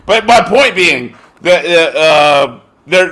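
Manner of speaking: ordinary talk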